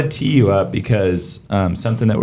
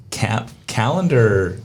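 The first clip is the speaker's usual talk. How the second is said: ordinary talk, nothing marked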